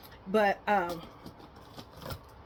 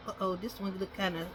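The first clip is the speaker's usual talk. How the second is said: ordinary delivery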